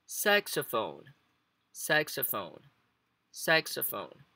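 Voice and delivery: saxophone modelled with SAX stressed; stress on first syllable. Shown as a demonstration. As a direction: hit SAX and let ophone trail down. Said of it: "a entonação entra no começo". (saxophone com a tônica no começo, no SAX)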